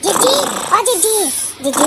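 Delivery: sweet sing-song summons; coaxing call. Acted. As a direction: wake big sister gently. Sister softly waking sister.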